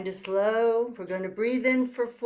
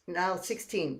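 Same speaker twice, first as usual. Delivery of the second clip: ordinary talk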